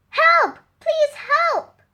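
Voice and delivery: high, loud frightened shout; desperate cry for help. Acted. Terrified Sam begging the village, loud high cry. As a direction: scream for help, truly scared.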